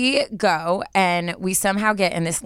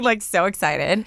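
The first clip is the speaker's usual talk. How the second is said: ordinary talk, nothing marked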